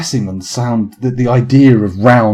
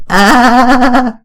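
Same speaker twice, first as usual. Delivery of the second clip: high, loud quavering "ah"; sustained high vibrato cry; fragile wobbling "ah"; 13 semitones higher, 6 dB louder than usual. Shown as a demonstration. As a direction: push out a high held wobble. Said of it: "that wasn't a very fragile sound".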